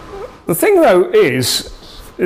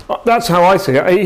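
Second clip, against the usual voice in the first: ordinary talk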